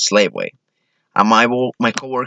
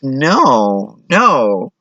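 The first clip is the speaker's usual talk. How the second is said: higher, emphatic double no; firm objection; 4 semitones higher, 3 dB louder than usual. Acted. Comic objecting to what parents tell girls, emphatic. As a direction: shut the idea down.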